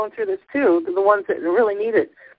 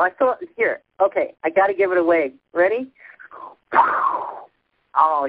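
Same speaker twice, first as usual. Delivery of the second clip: ordinary talk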